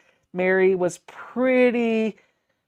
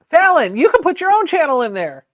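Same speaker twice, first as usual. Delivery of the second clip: playful call-out; 8 semitones higher, 8 dB louder than usual. Real, not acted. Teasing a chat friend.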